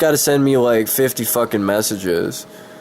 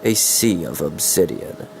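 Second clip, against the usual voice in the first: dramatic story narration; 2 semitones lower than usual. Acted. Streamer reads his fantasy story to viewers, dramatic narration. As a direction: read it like an epic fantasy audiobook. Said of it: "trying to be all fucking dramatic".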